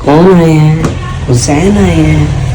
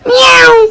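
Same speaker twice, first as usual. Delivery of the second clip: high squealing cat meow; meow imitation; slower than usual. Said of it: "म्याऊं करके दिखा दो". (ऊँची आवाज़ में बिल्ली की म्याऊं)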